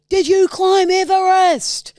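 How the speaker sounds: high, drawn-out mock shout; loud concert yell. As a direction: yell it slowly over a loud crowd. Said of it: "said it was loud".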